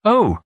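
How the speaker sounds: high startled little oh; mimicking her startled oh; mock exclaim. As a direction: imitate a sudden faint cry. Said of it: "you says".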